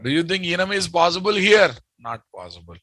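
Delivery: usual speaking voice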